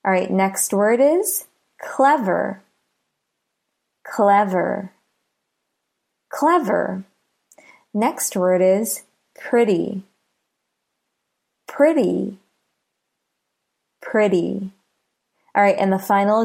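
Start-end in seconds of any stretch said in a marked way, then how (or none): 1.8-7.0 "clever" with CLE stressed; stress on first syllable. Shown as a demonstration. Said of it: "Listen to the first syllable". 9.3-14.7 "pretty" with PRE stressed; stress on first syllable. Shown as a demonstration. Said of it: "Listen to the first syllable".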